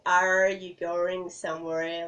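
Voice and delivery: exaggerated American accent, slowed down. Shown as a demonstration. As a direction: stretch the R in "Are" and "somewhere" like an American. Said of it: "Americans stretch their R's a lot".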